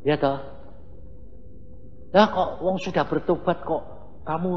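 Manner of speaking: ordinary delivery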